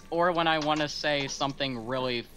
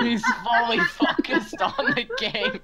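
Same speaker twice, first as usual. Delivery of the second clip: laughing narration; 4 dB louder than usual. Pretend. Mocking narration of Jesse gaming.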